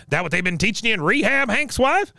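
high-pitched needling old-timer impression; Cotton. Recapper as Cotton mocking his daughter-in-law's rehab, high-pitched needling. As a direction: pitch it way up, climbing into a taunting question. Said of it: "then up comes Cotton. He goes".

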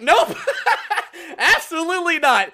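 laughing no to a stranger's offer; laughing refusal. Retold or imitated. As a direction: laugh off the idea with a firm no.

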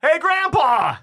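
loud high call across a site. Acted. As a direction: shout a cheery greeting. Stepping out, calling to him.

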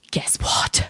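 excited hushed whisper; whispered secret. Retold or imitated. Imitating sister Vange, breathless excited whisper. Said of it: "it's like".

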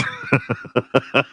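high giggle; real laughing burst. Real, not acted. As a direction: crack up at own bit. Laughs at own joke.

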